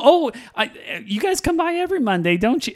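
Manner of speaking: high-pitched imitation of small talk; mock casual chat. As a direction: parody an everyday hello to regular visitors. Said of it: "it's just like a normal".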